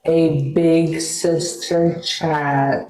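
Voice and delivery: very slow, stretched-out words; drawn-out catchphrase. Pretend. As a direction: stretch every word out like a slogan.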